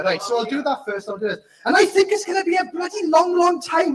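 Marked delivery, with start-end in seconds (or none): 1.6-4.0 higher, louder furious Welsh lyric rant; angry Welsh accent. Pretend. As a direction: spit the line out like a fuming Welshman. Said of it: "Angry Welsh accent".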